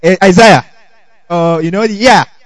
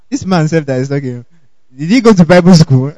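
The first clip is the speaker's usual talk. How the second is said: lightly acted sceptical aside, pitched down; mock doubting listener; 4 semitones lower than usual. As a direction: question the preacher's training under cover. Doubting churchgoer voiced in a story.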